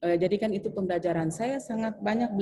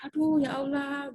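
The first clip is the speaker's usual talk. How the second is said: exasperated sigh; 7 semitones higher, slower than usual. Retold or imitated. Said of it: "sighing at the stingy colleague".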